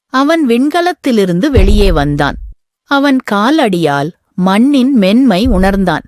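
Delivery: ordinary delivery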